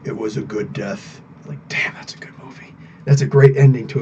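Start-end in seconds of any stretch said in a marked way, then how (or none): 0.0-1.2 low, hushed narrator line; quiet, reverent quotation; solemn movie quote. Acted. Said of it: "who was quoting it was like". 1.4-2.7 hushed, whispered appreciation; very soft, unvoiced aside; awed whisper. Real, not acted. Awed aside after quoting film ending.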